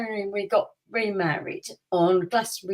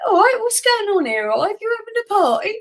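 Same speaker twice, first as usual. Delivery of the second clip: much higher, louder cheery mock greeting; jokey dad; 13 semitones higher, 5 dB louder than usual. Joking dad asks the swarming police if it's a party, cheery and loud. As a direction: breeze in like the family joker greeting guests.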